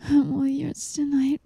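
normal talking voice

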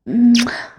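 loud close kiss; smacking kiss; kiss sound. Acted. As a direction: kiss right into the mic. Kissing the listener.

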